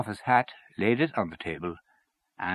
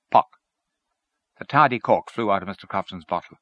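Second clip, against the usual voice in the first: plain reading voice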